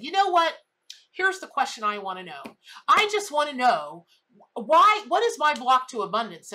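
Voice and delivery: loud, high demanding voice, pushing the question; demanding client. Retold or imitated. A tarot client pressing the reader, loud and high-pitched. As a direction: mimic the client who insists on an answer.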